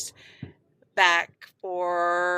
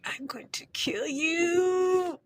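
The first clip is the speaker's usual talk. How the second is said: high, drawn-out mock wail of a threat; mock pained threat; 8 semitones higher, 4 dB quieter, slower than usual. Daughter to dad who smacked her sunburn, mock threat. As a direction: stretch it out in exaggerated agony, pitched high.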